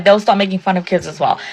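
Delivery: ordinary talk, nothing marked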